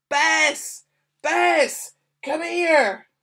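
full shout, high and loud, calling a dog; yelling. A narrator's reading. Dad calls the lost dog in from the storm, yelling. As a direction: yell it out across the wind. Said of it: "Dad yelled".

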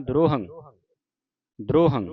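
ਦਰੋਹੰ said fast with the first sounds joined as droham; quick pronunciation. Shown as a demonstration. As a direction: run ਦਰੋਹੰ together quickly. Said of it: "ਜਲਦੀ ਦੇ ਨਾਲ ਉਚਾਰਨ ਕਰਨਾ".

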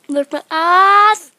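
slow, very loud punchline; loud cheeky joke. Pretend. Cheeky punchline for viewers, slow and very loud. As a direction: belt out the rude word slowly. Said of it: "gonna get flagged on YouTube".

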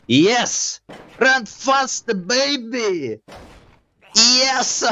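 high, gleeful victory yelling; excited cheer. Real, not acted. Gamer cheering his escape, excited high yell. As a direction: cheer the win wildly.

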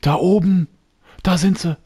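high excited calling out; excited shout. Real, not acted. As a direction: call out, you've spotted them. Spotting boars excitedly.

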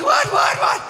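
very high-pitched frantic shouting; panicked mock yell. Dad to French guide over the din, high frantic yelling. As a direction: yelp it like someone who can't hear.

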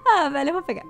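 laughing through the line. Real, not acted. Joking to viewers about the smell perk, laughing. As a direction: crack up at your own joke. Joke about vampire not bathing.

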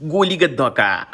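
loud, high catchphrase impression; Suraj Chavan. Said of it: "Suraj Chavan".

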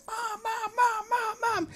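put-on high, urgent child calling; calling for mom. Little girl running off calling her mom. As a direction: keep crying out for mom while running. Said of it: "you hear the girl going".

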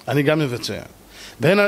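normal talking voice

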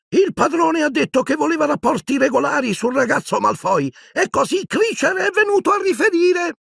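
very high, strained house-elf voice; a servile old elf, reporting stiffly. A narrator's reading. Servile elf reporting to Harry, very high strained voice. Kreacher's voice in audiobook.

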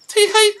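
hammed-up girly tee-hee; girlish giggle. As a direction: giggle cutely like the farm girl. Voicing Malon's giggle.

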